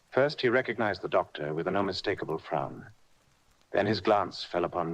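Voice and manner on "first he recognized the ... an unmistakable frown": usual speaking voice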